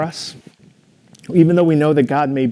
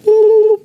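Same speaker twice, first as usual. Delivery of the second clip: high, loud squirrel squeak; playful pet squirrel greeting his owner, high squeak; 8 dB louder than usual. Retold or imitated. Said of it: "he goes".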